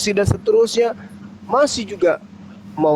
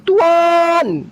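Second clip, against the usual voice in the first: higher, louder desperate plea; pleading woman; 14 semitones higher, 4 dB louder, much slower than usual. Retold or imitated. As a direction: cry out for help. Voicing begging mother. (memohon dengan suara tinggi)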